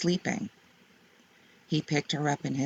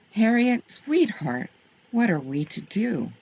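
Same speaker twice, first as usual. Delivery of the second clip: high on the endearments, settling down for the question; mildly exasperated mother, not yelling; restrained exasperation; 7 semitones higher than usual. A narrator's reading. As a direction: plead lightly instead of shouting. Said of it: "Her mother didn't like to yell".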